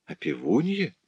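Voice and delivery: surprised. A narrator's reading. Rudolph echoes his neighbour's news, surprised. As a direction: echo it softly in astonishment. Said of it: "с удивлением спросил Рудольф".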